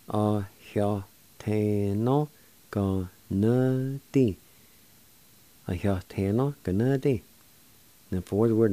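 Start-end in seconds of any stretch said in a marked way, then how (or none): 0.1-4.4 "A heo te no. Go na di." slowly, every syllable stretched; slow, careful pronunciation. Shown as a demonstration. Teacher putting "A heo te no. Go na di." together for learners, slowly. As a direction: draw out each syllable of "A heo te no. Go na di.". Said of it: "And together".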